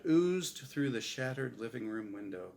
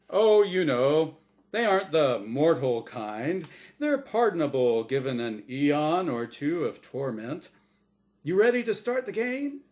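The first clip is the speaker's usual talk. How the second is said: booming, bright host voice; game show host; 3 semitones higher, 6 dB louder than usual. Acted. The angel Azrael hosting his show for Norm, big host voice. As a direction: sell it like a TV quiz host.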